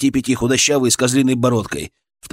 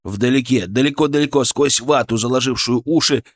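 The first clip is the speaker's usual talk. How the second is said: ordinary delivery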